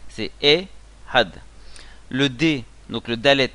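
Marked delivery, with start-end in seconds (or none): 0.4-1.3 E, a gap, then Had as one syllable; two syllables. Shown as a demonstration. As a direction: split it as "E" and "Had". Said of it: "Il y a deux syllabes".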